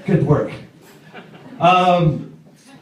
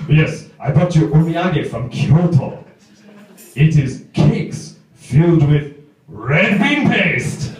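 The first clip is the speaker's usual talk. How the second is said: put-on very slow, deliberate Japanese-accented English; Japanese coworker; slower than usual. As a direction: draw out each phrase in a Japanese accent. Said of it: "omiyage from your coworkers".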